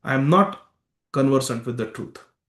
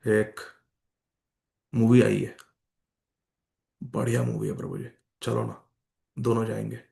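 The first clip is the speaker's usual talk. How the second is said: low, soft coaxing; 3 semitones lower, 3 dB quieter, much faster than usual. Acted. Devotee talking a friend into a movie, coaxing. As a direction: coax a friend along quietly.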